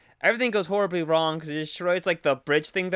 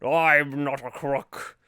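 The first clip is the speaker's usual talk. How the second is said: Richard Nixon voice; 2 semitones lower, much slower than usual. Retold or imitated. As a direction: drawl it out like Nixon. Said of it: "sound like Richard Nixon".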